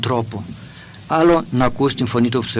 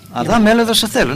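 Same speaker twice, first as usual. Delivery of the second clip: a raised, commanding call of «Αδάμ, έλα εδώ»; higher, louder stern command to Adam; stern summons; 10 semitones higher, 4 dB louder, faster than usual. Shown as a demonstration. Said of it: "Ή θα μπορούσε ο Θεός".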